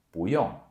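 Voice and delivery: 不用 as bú yòng, rise then fall; 不用 with bu rising, yong falling; correct tones. Shown as a demonstration. Teacher leading repetition of the correct 不用. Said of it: "repeat after me".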